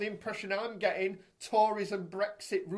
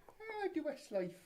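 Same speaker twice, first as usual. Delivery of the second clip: high, soft mock Irish lilt; Louis Walsh; 7 semitones higher, 6 dB quieter than usual. Host mimics an Irish celebrity, high mock Irish accent. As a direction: drift into a faked Irish impression. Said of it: "It sounds a bit like Louis Walsh".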